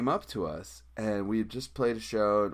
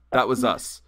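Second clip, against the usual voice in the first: louder, steady "That was us"; firm repeat; 7 dB louder than usual. Retold or imitated. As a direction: repeat it firmly. Confronting the band.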